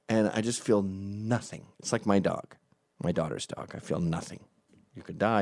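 ordinary delivery

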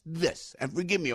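ordinary delivery

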